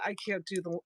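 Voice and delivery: ordinary talk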